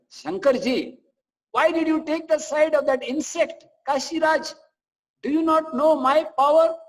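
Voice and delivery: high, loud furious rebuke with a forced smile; Krishna smiling angrily, rebuking Shiva in a high, loud voice; angry smile. Acted. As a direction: thunder at him, smiling through the anger. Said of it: "Krishna smiled angrily".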